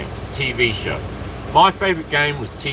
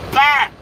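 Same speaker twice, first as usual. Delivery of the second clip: shouted smash sound; 13 semitones higher, 6 dB louder than usual. Pretend. Can smashing against wall.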